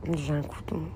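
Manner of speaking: tired and quiet. Real, not acted. Streamer repeats that she is drained, low and worn out. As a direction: let J'ai un coup de mou deflate like a weary sigh.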